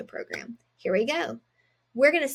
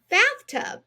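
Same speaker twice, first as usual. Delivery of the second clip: "Bathtub" much higher and louder; cheerful reveal; 11 semitones higher, 5 dB louder than usual. Shown as a demonstration. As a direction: sing out "Bathtub" as the big finish. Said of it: "We read it together".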